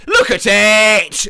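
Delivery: loud, slow, drawn-out LOOK AT IT; proud shout. Pretend. Seller shouting to the buyer to look at his homemade lasagna, loud and slow. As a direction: bellow it out, showing off the lasagna.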